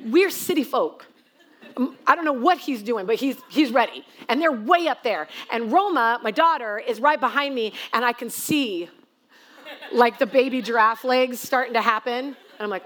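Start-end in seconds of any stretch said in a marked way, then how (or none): none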